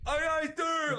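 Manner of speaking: high, loud recruit shout with "sir" lisped as "thir"; screamed with lisp. Retold or imitated. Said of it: "and with his lisp".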